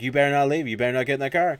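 acted-out cross wife; scolding wife. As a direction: warn your husband off firmly. Wife warns departing husband.

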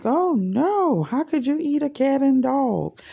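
high scandalized voice, hammed up; mock outrage. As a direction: play the shocked, judging American. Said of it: "we be like".

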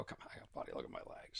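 whispered, half-confiding; conspiratorial whisper; mock whisper. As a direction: drop to a sly whisper. Retold routine, hushed version.

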